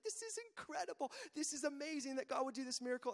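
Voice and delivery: high-pitched burst of amazed delight; excited Mary. Retold or imitated. Mary reacts to the angel's news of a miracle, excited and high. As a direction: act out her thrill, pitch leaping up. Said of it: "Mary is so excited".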